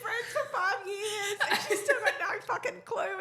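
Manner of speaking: high loud laughing fuck, babes; laughing loudly. Real, not acted. Telling a friend about a clueless old pal, laughing. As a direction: laugh it out in delight.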